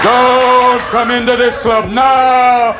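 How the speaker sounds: slow booming wizard warning. Retold or imitated. Bud as the Wizard of Oz warning newcomers, slow and grand. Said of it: "like the Wizard of Oz, going".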